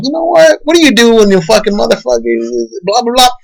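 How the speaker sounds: high, strained, shouting at the end; Joker's last words. Retold or imitated. Joker shooting the talk show host, high shout. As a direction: pitch up and spit out the last line. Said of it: "he's all like".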